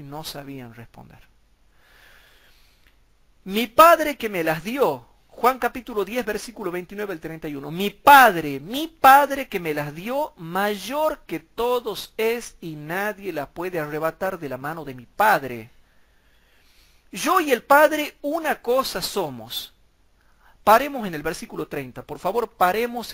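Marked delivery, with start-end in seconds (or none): 3.4-5.1 slow, loud verse read; solemn scripture read. Shown as a demonstration. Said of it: "reads the verse". 7.7-19.7 loud, slow proclamation of the verse; preached scripture, loud and slow; emphatic scripture reading. Shown as a demonstration. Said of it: "reads the verse".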